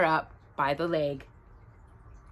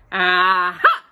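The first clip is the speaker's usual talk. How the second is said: loud, drawn-out aha with a punch; big storybook "aha"; delighted discovery; 2 semitones lower, 6 dB louder than usual. A narrator's reading. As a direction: exclaim as if you just found something. Pooh discovers a hole.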